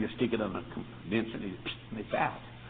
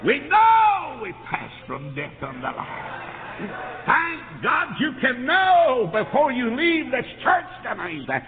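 high, loud, slow fervent preaching; preaching at full force; 10 semitones higher, 7 dB louder, slower than usual. Acted. Preacher to congregation, high loud fervent preaching. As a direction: shout the assurance to the church.

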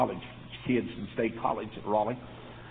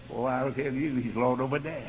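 ordinary talk, nothing marked

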